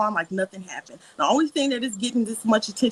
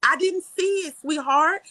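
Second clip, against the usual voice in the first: condescending; 7 semitones higher, slower than usual. Real, not acted. Condescending jab in argument.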